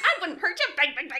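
high, loud voice shaking with laughter; laughing killer. Retold or imitated. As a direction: cackle through the lines like the killer. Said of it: "He is laughing the whole time".

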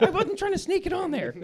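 high-pitched mock defensive whine; high whiny protest. Dad mocks his own defensive protest, high and whiny. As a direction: whine it like a caught kid, laughing. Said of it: "I was like".

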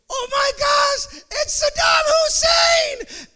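high, loud frightened shriek, dragging out "Saddam"; panicked child scream. Retold or imitated. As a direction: scream like a terrified kid. Child self panics at thunder.

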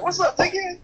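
high swaggering greeting; chest-slapping Curry. Retold or imitated. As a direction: greet him high and cocky. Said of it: "He got the rings on".

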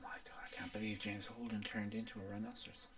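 hushed mock spoiler whisper. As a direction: whisper it like a secret.

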